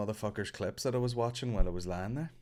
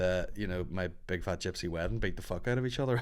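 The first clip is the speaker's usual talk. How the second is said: ordinary talk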